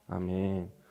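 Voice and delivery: ordinary talk, nothing marked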